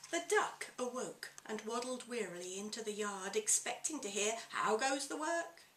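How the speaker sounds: lively picture-book reading aloud; story narration. A narrator's reading. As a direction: read it to children with relish.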